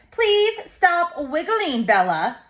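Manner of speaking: slow, drawn-out plea starting high, dropping on the name; mildly exasperated parent's plea; exasperated pleading. A narrator's reading. Mommy to wiggling child while shopping, mildly exasperated.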